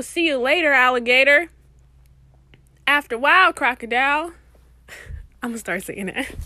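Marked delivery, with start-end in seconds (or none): 0.0-4.3 higher, louder singsong; louder, higher singsong sign-off; playful rhyme. Shown as a demonstration. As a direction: bounce it out like a playground rhyme. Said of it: "So, ooh".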